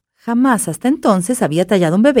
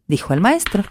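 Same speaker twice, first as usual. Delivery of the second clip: ordinary delivery, nothing marked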